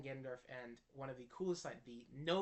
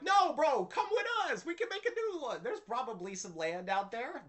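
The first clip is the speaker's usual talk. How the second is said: much higher, louder pleading; pleading with the king; 10 semitones higher, 9 dB louder than usual. Retold or imitated. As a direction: beg him to come along, hammed up. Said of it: "And you're like".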